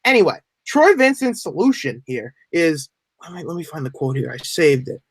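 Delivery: ordinary delivery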